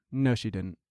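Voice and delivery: low, soft retold denial; calm denial. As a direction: deny it calmly and quietly. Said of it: "just calmly looks at me and goes".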